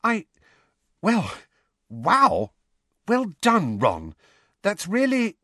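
high, halting amazement; bewildered. A narrator's reading. Hermione trying to congratulate Ron, bewildered. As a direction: stumble through congratulations, pitch high. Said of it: "looking thoroughly bewildered".

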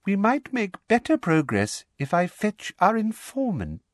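high, silky suggestion; silky, pitched well up; Umbridge, silky. A narrator's reading. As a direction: smoothly coax in a high voice. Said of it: "said Umbridge silkily".

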